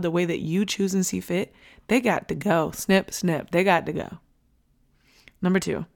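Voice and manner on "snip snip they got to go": usual speaking voice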